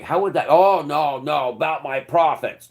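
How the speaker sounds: high, loud sarcastic rich man whining; hammed-up greedy owner protecting profits; mock greedy landowner. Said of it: "greedy owner voiced mockingly".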